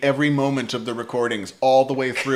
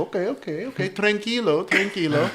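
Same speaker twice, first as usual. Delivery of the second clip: put-on higher, placating Colombian accent; Gustavo; 7 semitones higher than usual. Producer calming the frustrated drummer, higher placating Colombian accent. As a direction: soothe as an imitated patient Colombian producer. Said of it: "he is the most patient motherfucker".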